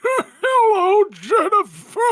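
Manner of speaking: high, loud, wailing dying Santa. Pretend. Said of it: "in your dying Santa voice".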